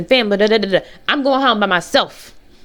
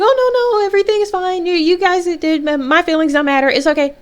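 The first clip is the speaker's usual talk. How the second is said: much higher, sugary fake-cheerful voice; fake nice; 10 semitones higher than usual. Hiding hurt from the therapy group, falsely cheerful. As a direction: sweetly pretend nothing is wrong. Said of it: "that's how I was on the outside".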